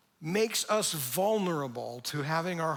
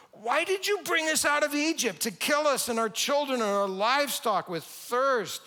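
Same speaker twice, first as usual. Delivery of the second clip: whiny complaint; 8 semitones higher, 3 dB louder than usual. Acted. People grumbling against Moses.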